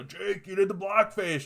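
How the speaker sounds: high, slow, sing-song accusing voice; mock accuser. Imagined critic confronting Jake over old blackface, high mock taunt. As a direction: taunt Jake like a gotcha troll, slowly.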